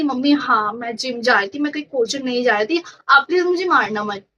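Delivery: normal talking voice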